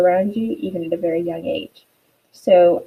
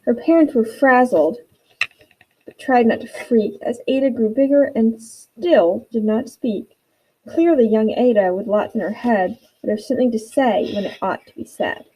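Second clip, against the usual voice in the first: lively rhyming storybook read; animated read-aloud of a rhyming picture book; reading to children; 2 semitones higher than usual. Acted. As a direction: read it aloud with bounce for young kids.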